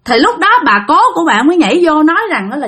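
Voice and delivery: plain reading voice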